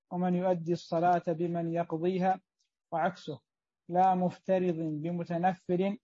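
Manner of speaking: normal talking voice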